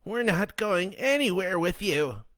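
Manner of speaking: high, loud sharp retort; grieving mother snapping at Len. A narrator's reading. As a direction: snap it back in anger. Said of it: "Clara snapped".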